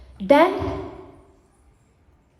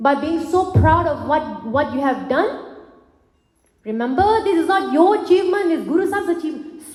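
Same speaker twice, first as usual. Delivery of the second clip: ordinary talk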